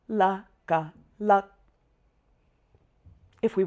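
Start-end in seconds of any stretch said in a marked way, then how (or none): 0.1-1.5 "La, ga, lak" as three detached beats; step-by-step oral spelling. Shown as a demonstration. Teacher repeating the spelling for learners, recited step by step. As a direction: recite "La", "ga", then the product "lak". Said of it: "say those two and then we say the product".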